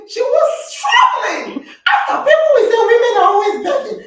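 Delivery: loud amused laugh while telling. Real, not acted. Laughing retelling of Rachel's hesitation.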